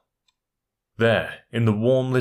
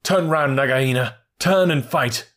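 higher, louder challenge; cried out; 5 semitones higher, 4 dB louder than usual. A narrator's reading. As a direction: call her out boldly. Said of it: "Rikki Tikki came up and cried".

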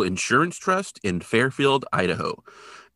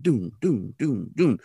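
thudding doom noises; slower than usual. Retold or imitated. Imitating barriers knocked over.

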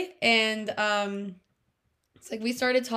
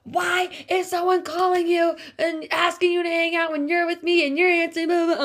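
higher, louder jealous demand; mock jealous yell; 8 semitones higher, 8 dB louder than usual. Imitating the jealous guy screaming at her in the car. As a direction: mimic his angry accusing tone. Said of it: "like, screaming at me".

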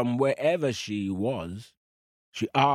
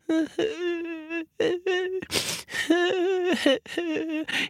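high wailing fake sob; hammed-up high crocodile sobbing; mock crying wail. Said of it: "He was crying crocodile tears".

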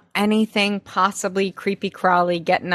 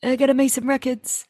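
put-on voice, pitched up; Daddy Davis impression; 4 semitones higher than usual. Daddy Davis saying he must make records, mimicked high voice. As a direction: mimic a missed character, higher than usual. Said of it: "I just miss that voice".

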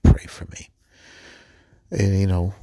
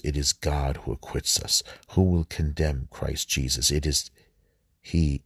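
measured, lower-pitched Bible reading; scripture reading; 3 semitones lower than usual. Acted. Man reading Paul's letter to the Romans to listeners, measured scripture reading. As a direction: read it out as a solemn lector would.

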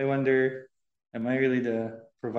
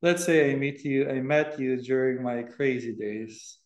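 louder, clearer restart; speaking up. Real, not acted. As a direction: raise your voice to be heard, keeping it slow. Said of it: "you're gonna have to speak louder".

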